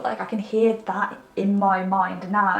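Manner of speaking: ordinary talk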